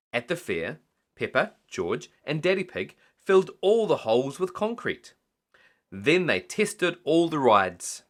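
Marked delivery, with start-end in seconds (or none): none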